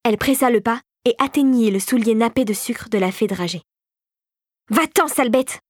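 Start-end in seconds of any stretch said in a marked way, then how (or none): 4.7-5.6 higher-pitched cry of revulsion. A narrator's reading. The fée dragée to an attacking mouse, crying out. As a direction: shoo it away with a raised voice. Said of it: "cria celle-ci".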